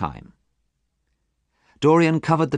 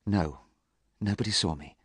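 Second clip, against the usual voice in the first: quietly; 7 dB quieter than usual. A narrator's reading. Dorian answering Harry's question, quiet soft reply. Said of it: "replied Dorian quietly".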